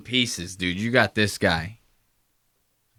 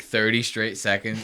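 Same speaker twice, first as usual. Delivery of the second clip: ordinary talk, nothing marked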